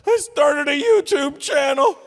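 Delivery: very high, loud, slow mocking kid voice; mock a smug influencer kid; whiny youngster.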